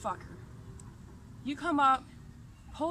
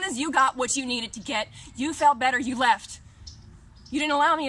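louder, heated accusation; angry; 7 dB louder than usual. Real, not acted. Venting at the friend who came and left, angry. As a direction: hammer each short clause.

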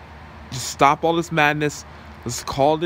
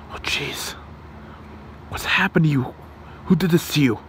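hushed, alarmed whisper; shocked whisper; 4 semitones higher than usual. Acted. Hero finds the tied-up listener, shocked whisper. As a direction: whisper in horror at the captive.

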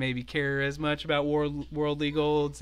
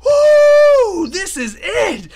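high, loud triumphant whooping; victory hoot; 10 semitones higher, 14 dB louder, slower than usual. Acted. Kobold leader to the party over treasure, hooting in victory. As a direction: whoop it up like you just struck gold. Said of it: "he hoots in victory".

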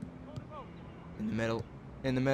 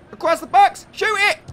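shout; 9 dB louder than usual. Real, not acted. Yelling at player.

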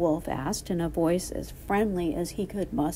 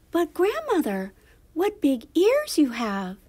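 put-on high, emphatic child's voice; wondering little girl; 9 semitones higher, 5 dB louder than usual. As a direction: marvel as a storybook little girl. Narrator voices amazed little girl.

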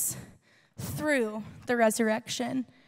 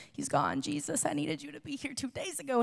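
grieving quiet reproach; 9 dB quieter, faster than usual. Retold or imitated. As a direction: speak it softly like someone who just lost a brother. Grieving sister reproaches Jesus.

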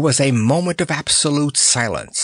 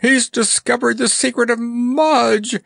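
very high-pitched shriek; shrill it out in frantic outrage; shrill alarm; 3 dB louder than usual. A narrator's reading. Monarch learns the clown knows the secret, shrieking shrilly. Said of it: "shrilled Mustafa".

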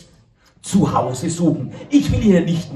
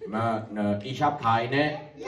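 a defiant goat who won't look up; cheeky goat; 3 semitones higher, 8 dB quieter than usual. Acted. Goat refuses, insults.

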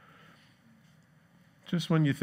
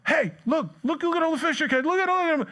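much higher, rapid excited calling; excited crowd; 13 semitones higher, faster than usual. Retold or imitated. Crowd on the shore cheering the catch, high excited calls. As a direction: point and cry out, thrilled bystander.

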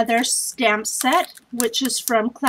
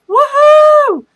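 loud high whoop for the winner; cheer; 11 dB louder than usual. Real, not acted. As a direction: whoop like you just won. Cheering the drawn prize winner.

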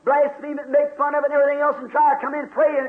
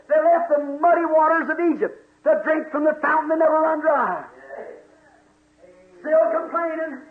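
ordinary talk

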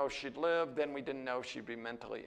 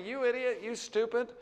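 blunt family insults; 8 semitones higher, 4 dB louder than usual. Retold or imitated. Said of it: "we would say things to each other like".

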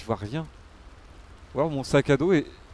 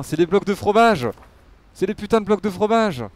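excited exclamation; 7 semitones higher, 6 dB louder than usual. Real, not acted. Gamer discovering the cheese blocks, exclaiming. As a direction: exclaim it high and loud, stretching it out.

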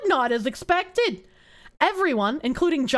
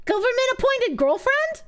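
mock proposal; 10 semitones higher than usual. Joking aside to chat.

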